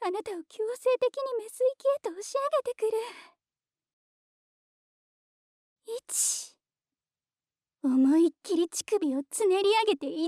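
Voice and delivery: rising excited command. Acted. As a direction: push it up to a peak. Climax of countdown, rising command.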